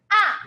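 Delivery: "ah" said short and falling, like a stressed yell; angry fourth tone. Shown as a demonstration. Teacher shows learners the fourth tone, angry stressed "ah". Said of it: "fourth tone will be like angry tone".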